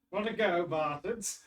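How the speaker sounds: yelled threat, loud and high; angry shout. Acted. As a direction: snarl it like a tavern thug. Rough NPCs threaten the party.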